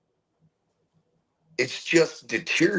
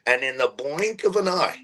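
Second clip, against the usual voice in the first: usual speaking voice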